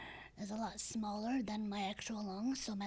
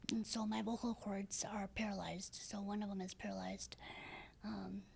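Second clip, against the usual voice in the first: breathy raspy voice. Real, not acted. Woman explaining to the interviewer why her voice is raspy, breathy and raspy. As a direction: speak with a paralyzed vocal cord. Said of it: "There's so much breath that comes out of me".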